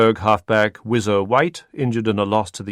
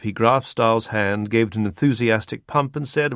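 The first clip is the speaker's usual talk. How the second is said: plain reading voice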